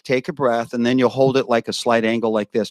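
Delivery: ordinary talk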